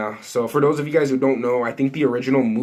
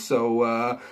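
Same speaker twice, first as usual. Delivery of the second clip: ordinary delivery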